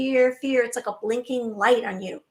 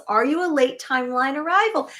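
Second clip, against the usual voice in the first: ordinary delivery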